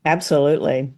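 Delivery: ordinary talk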